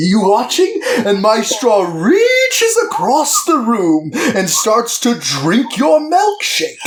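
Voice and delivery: hammed-up, high swooping theatrical villain; big slow impression, swelling to a drawn-out finish; iconic movie monologue. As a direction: stretch the key words out, slow and gleeful. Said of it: "this is the iconic milkshake quote".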